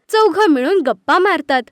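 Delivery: usual speaking voice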